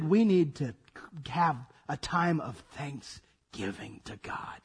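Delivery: hushed whisper. Retold or imitated. Preacher voicing struggling Pilgrims in a whisper. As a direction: breathe it out like a secret vow.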